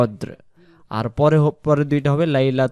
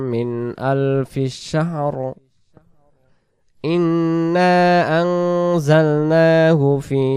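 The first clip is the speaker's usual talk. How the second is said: slow, melodic Quran recitation; much slower than usual. Acted. Teacher recites Surah Qadr for the students, melodic. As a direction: recite each phrase slowly in tajweed.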